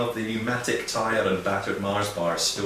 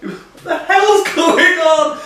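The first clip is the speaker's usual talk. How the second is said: high, loud, drawn-out mock disbelief; hammed-up baffled cry; mock bewilderment; 9 dB louder, much slower than usual. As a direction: wail it slowly in acted bafflement. Acting out bewilderment at the dialect.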